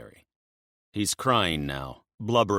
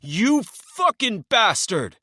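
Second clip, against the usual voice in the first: slow, high, full-throated roar; 8 dB louder, slower than usual. Acted. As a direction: roar it with betrayed rage. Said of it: "Emil roars".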